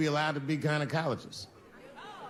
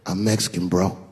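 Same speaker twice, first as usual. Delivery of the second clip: cool and casual; 9 semitones lower, 5 dB louder than usual. Retold or imitated. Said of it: "her husband was just cool about the shit".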